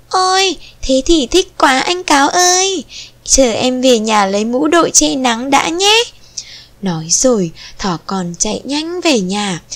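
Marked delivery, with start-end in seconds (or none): 0.1-6.0 put-on high-pitched, eager young rabbit; feigned excitement. A narrator's reading. Little rabbit tricks the fox into waiting, feigned excitement. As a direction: gush with put-on enthusiasm. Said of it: "giả vờ hào hứng đáp lời".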